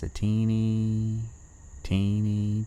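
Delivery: put-on high, slow, stretched-out "teeny"; drawn-out teeny. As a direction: draw each teeny out to make it tiny. Stretching the word for tiny peril.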